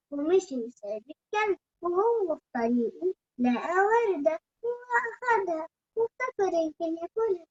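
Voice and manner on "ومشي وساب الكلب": ordinary delivery, nothing marked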